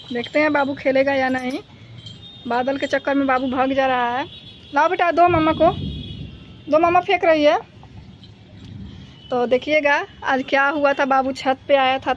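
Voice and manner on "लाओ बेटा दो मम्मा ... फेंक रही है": pet talk. Real, not acted. Coaxing the dog to give ball.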